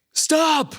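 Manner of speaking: put-on high, loud little girl yelling stop; high, loud shout from a little girl at the end of the aisle; child's shout. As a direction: shout it like a five-year-old halting a runaway toddler. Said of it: "she just goes".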